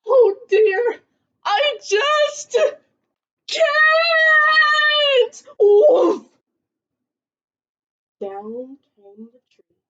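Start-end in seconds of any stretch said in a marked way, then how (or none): 0.1-5.4 high, loud crying shout; Kevin, clinging to the tree, cries out in fear; frightened wail. Acted. Scared Kevin refusing to leap. 5.6-6.3 loud high mock bang; a hammed-up crash as the tree comes down; crash sound.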